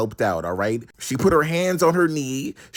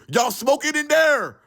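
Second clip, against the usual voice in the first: authority yelling; 12 semitones higher than usual. Retold or imitated. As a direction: bark it like a guard catching kids. Official shouting through the door.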